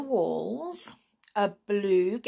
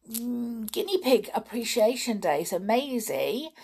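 ordinary delivery, nothing marked

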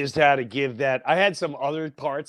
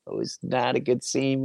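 ordinary delivery

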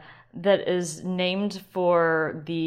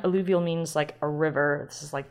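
ordinary delivery

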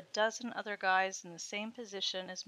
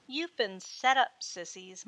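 mocking; 5 dB louder, slower than usual. Sorority girl taunting the captive freshmen, mocking. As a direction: sneer it out at the bound pledges, drawn out. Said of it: "mocked one of the girls".

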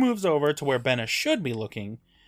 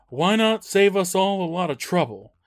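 mild menacing dad; 5 semitones higher than usual. Retold or imitated. Father character voiced, slow menace.

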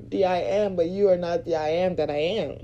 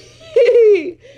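loud high laugh; 12 dB louder than usual. Real, not acted. Laughs at own remark.

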